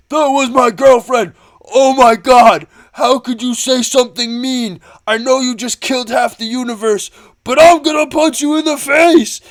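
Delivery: loud, high, whiny mock tantrum; hammed-up outburst. Peter Quill losing it at Thanos over his girlfriend, mock hysterical shouting. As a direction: wail and rage like an overwrought hothead, hammed up.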